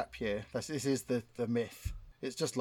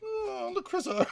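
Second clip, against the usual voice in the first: much higher, slow comedian voice; James Acaster impression; slower than usual. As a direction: stretch "Chrisos" out slowly, pitched way up. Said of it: "Impression of James Acaster".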